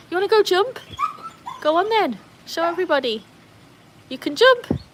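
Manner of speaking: high-pitched playful encouragement; excited pet talk; dog-coaxing voice. Real, not acted.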